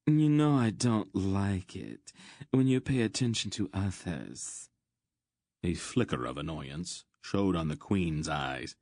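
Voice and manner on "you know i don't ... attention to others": higher-pitched sulky complaint; pouting reproach; petulant. Acted. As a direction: whine like a jealous, spoiled favorite. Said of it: "ordered petulantly".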